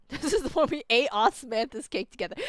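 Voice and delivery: usual speaking voice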